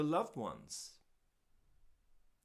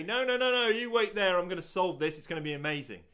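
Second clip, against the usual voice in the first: much higher and louder, a hammed-up rush to fix everything; mock eager rescuer; 5 semitones higher, 8 dB louder than usual. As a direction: play the overeager fixer brushing them off. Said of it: "He doesn't say".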